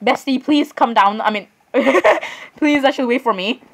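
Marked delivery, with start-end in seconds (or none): 1.7-2.3 short giggle; brief burst of laughter; laughing. Real, not acted. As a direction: let out a quick laugh. Laughs while following another player.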